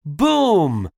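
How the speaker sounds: high exclaimed boom, falling away; cannon boom. Acted. Narrator voices the cannons firing, booming exclamation. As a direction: imitate a cannon blast. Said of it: "went the cannons".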